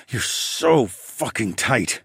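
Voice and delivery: slow, strained growl; drawn-out growl of pleasure. A narrator's reading. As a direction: growl it slowly, stretching the words. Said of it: "I growled".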